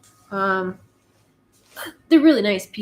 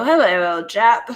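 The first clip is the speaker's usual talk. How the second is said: mock-posh old Hollywood accent, louder; posh transatlantic accent; 6 dB louder than usual. Joking about talking like old film stars, mock-posh accent. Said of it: "it's a very posh sounding accent".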